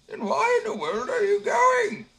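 put-on very high, slow, shouting old man; an astonished squeaky little man; old man baffled by the simpleton. A narrator's reading. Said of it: "said the little man".

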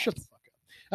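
whispered playful insult; whispering. Real, not acted. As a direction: breathe it out in a whisper.

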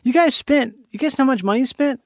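high-pitched mock disbelief, quickening; acted incredulity. Creator questioning the network about wasted money, acted incredulous. Acts out confronting the network executives.

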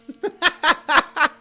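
short high laugh. Real, not acted. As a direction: burst into a laugh. Laughs at own story.

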